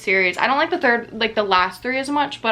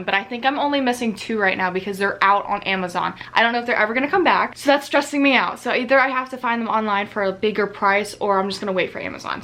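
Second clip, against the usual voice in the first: usual speaking voice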